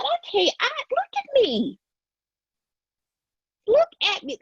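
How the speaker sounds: very high, squeaky voice; playfully high-pitched chipmunk voice. Real, not acted. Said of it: "this chipmunk voice".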